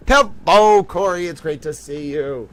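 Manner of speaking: normal talking voice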